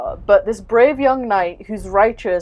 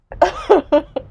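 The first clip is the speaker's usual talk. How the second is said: squealing laugh, much higher; high burst of laughter; 10 semitones higher, 4 dB louder than usual. Real, not acted. After trashing the gods, a high laugh. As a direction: let out a high, sudden laugh.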